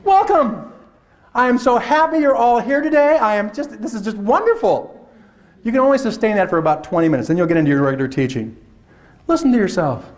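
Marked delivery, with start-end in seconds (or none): 0.1-5.1 much higher, gushing first-day cheer; over-the-top teacher welcome. Retold or imitated. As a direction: greet the class like a sugary morning host. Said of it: "don't listen to that first twenty minutes".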